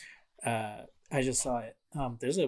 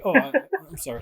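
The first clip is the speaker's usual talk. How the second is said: much higher, louder startled sorry; mock sheepish apology; 10 dB louder, faster than usual. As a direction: caught out, apologizing in a squeak. Player apologizes to the squirrel.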